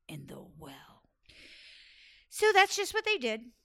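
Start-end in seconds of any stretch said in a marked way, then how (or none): 0.1-1.0 lower, hushed scheming whisper; whispered order. Acted. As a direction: hiss the order secretly.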